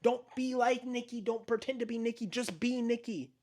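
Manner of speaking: high mock insistent boyfriend; imitating a whiny demand.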